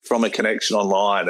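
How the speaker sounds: touched and amazed; excited. Retold or imitated. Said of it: "And I'm like".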